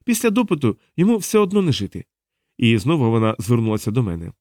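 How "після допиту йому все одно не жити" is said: high-pitched, offhand verdict; dismissive. A narrator's reading. Diana waves away concern about the prisoner, lightly dismissive. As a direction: brush it off in a raised voice. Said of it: "відмахнулася Діана".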